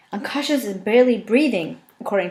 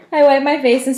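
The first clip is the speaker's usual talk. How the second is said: higher, louder words still in laughter; laughing through it; 7 semitones higher, 10 dB louder than usual. Real, not acted. Reader pushes on through laughter. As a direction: read on while still laughing. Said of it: "This is so stupid".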